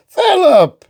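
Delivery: high loud call; shouted. Acted. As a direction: call across the courtyard. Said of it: "King Hubert shouted".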